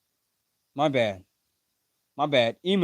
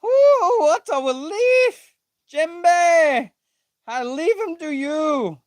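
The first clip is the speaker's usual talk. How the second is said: very high, louder squeal of relief; overexcited read; 12 semitones higher, 5 dB louder, slower than usual. Acted. Reading a manga line with giddy high relief. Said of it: "I don't know why I'm reading it like that".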